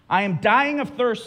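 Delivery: put-on high, loud plea of a parched girl; desperate girl Jill. Preacher voicing Jill begging the lion, high and loud. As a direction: cry out like a girl dying of thirst. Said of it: "says Jill".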